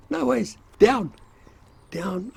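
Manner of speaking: retold army instructor shouting orders at the recruits; mock drill order. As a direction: bark it like a drill instructor sending troops down. Instructor barking orders on mine dump.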